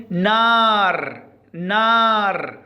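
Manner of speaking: نار very slow, the aa held long; long aa demo. Shown as a demonstration. Teacher modelling the word for pupils, نار slowly drawn out. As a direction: stretch the vowel in نار. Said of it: "نقول".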